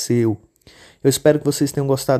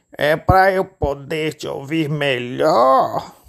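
slow, drawn-out sly wolf voice; wolf answering with false sweetness; wolf as grandma; 2 semitones higher, much slower than usual. Acted. Lobo disfarçado responde. (lobo disfarçado, fala arrastada)

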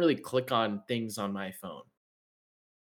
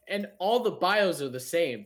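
ordinary talk, nothing marked

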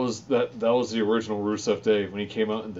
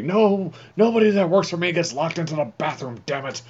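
loud angry boss yell; bellowing furious boss; Vince shouting; 8 semitones higher, 6 dB louder than usual. Retold or imitated. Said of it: "Vince was like".

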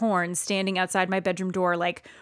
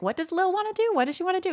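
high, light questioning voice; softly high-pitched mock curiosity; retold prying question; 9 semitones higher, 5 dB quieter, much faster than usual. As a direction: imitate asking nosily, pitched up. Retold nosy questioning about a secret.

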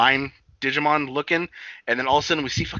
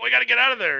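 high, louder urgent quote; imitated alarm, pitched up; mock urgent cry; 10 semitones higher, 6 dB louder, faster than usual. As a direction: act out the crew wanting to flee. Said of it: "they're like".

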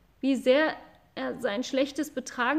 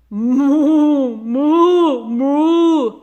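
loud long lowing moo; cow bellowing; 3 semitones higher, 14 dB louder, much slower than usual. Acted. Cow bellows at the boy, loud drawn-out moo. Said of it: "brüllte Mairos".

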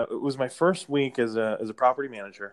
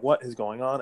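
ordinary talk

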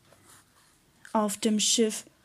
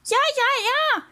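very high gull screech; seagull screeching; 4 dB louder than usual. A narrator's reading. Seagull confirming the sighting, screeching. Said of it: "kreischte die Möwe".

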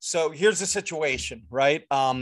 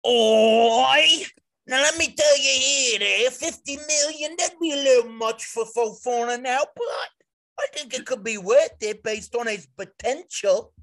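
Chelsea fan; 7 semitones higher, 4 dB louder than usual. Acted. Dipshit Chelsea fan posting on Reddit, hammed-up impression. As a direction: put on a high, caricatured Chelsea supporter. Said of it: "you'll see some dipshit Chelsea fans on Reddit posting it, being like".

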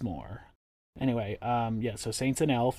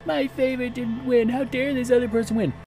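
high, hammed-up entitled whine; mocking whiny fan; 12 semitones higher than usual. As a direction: parody a sulking fan, pitched way up. Mocking upset fans after finale.

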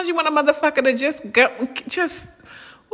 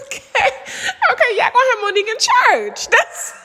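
excited laugh; 12 semitones higher than usual. Real, not acted.

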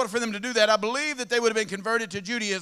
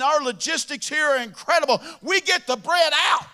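proud shout; 4 semitones higher, 3 dB louder than usual. Acted. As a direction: belt it out like a proud salesman. Guy boasting about delivery.

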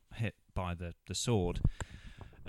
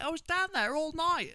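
very high mock outrage; high, hammed-up livid complaint; livid woman. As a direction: squeal it like a wronged captive. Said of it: "She's like".

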